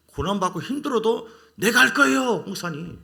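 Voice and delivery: high, loud overeager promise; a touch of hammed-up zeal; mock eager vow. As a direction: play the believer swearing devotion to the pastor. Mimics overzealous believer to pastor.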